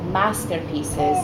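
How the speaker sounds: ordinary delivery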